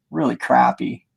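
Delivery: ordinary delivery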